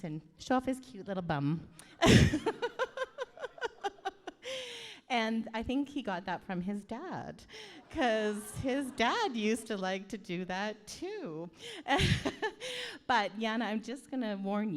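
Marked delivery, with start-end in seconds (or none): 1.7-5.0 long, high, loud peal of laughter; real cackle. Real, not acted. Auntie promises embarrassing stories, long high cackle. Aunt laughs at own story. 11.5-13.0 short, high burst of laughter; real giggle. Real, not acted. Auntie teases groom and his dad, short high laugh. Aunt laughs at own story.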